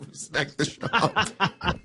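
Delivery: slow, drawn-out words through laughter; helpless with amusement; laughing echo. Real, not acted. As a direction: crack up while repeating it. Laughing at co-host's mock defence.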